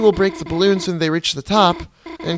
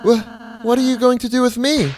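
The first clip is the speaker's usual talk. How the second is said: frightened; 9 semitones higher than usual. Acted. Noelle frightened.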